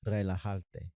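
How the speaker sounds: "relajarte" carefully sounded out with emphasized R; overemphasized R. Shown as a demonstration. Learner showing how beginners say it, "relajarte" with overemphasized R. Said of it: "I'm really emphasizing the R".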